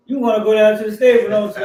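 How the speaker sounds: quick, loud knowing jab at the son; knowing tease. Retold or imitated. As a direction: needle him, you already know the answer. Dad teasing son who wants stable.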